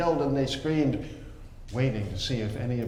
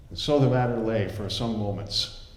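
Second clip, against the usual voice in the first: usual speaking voice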